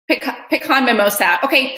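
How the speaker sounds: normal talking voice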